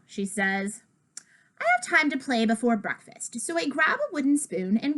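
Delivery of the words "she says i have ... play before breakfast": plain reading voice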